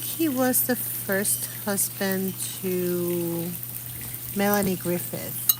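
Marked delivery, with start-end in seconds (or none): none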